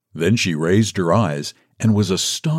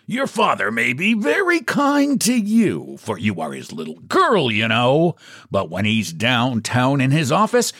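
lightly voiced salesman, swinging high in pitch; a hint of a pompous, affronted visitor; aggrieved book agent; 8 semitones higher than usual. A narrator's reading. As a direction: air a grievance with swooping emphasis.

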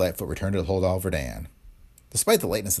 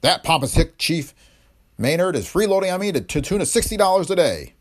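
indignant outburst; 10 semitones higher, 5 dB louder than usual. A narrator's reading. Hotel owner fuming to the district attorney, high indignant outburst. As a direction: spit out the complaint about the freeloading chief. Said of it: "He smote his desk indignantly".

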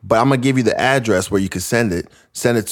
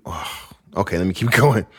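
ordinary delivery

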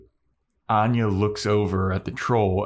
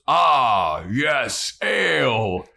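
troll voice; 4 semitones higher, much slower than usual. Acted. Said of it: "the troll stops him and he goes".

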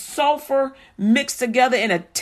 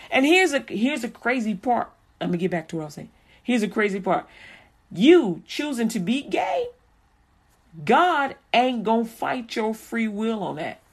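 ordinary talk, nothing marked

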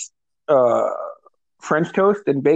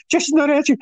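much higher, anxious mother; worried mom; 12 semitones higher, faster than usual. Retold or imitated. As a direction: fret over the missing daughter.

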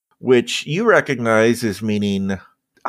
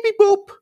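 high robotic beep boop; computer beeps. Acted. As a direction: chirp it like a ship computer. Ship computer chirps.

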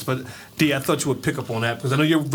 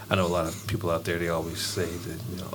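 serious voice; 10 semitones lower, 7 dB quieter, faster than usual. Shown as a demonstration. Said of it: "This is my serious voice".

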